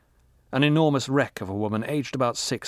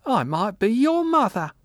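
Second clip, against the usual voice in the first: put-on drunk old woman; 12 semitones higher, 5 dB louder than usual. A narrator's reading.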